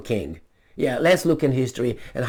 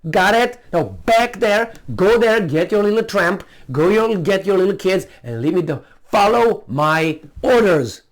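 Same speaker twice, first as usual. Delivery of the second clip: loud, threatening tirade, higher and louder; mock furious king; 6 semitones higher, 4 dB louder than usual. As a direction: bark orders like a brutal king. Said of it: "Instead of saying".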